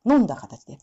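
ordinary talk, nothing marked

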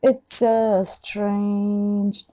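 slow it's a strange; drawn out model line; ゆっくり; slow demo. Shown as a demonstration. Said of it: "model line".

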